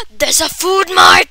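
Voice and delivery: loud gasp then shout; thrilled to spot the store; excited gasp and shout. Real, not acted. Kid spots a food mart, gasping excitedly.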